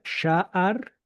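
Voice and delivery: sha'ar said slowly with the stress on the first A. Shown as a demonstration. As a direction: draw out sha'ar and lean on the first A. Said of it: "con el acento en la A, primera".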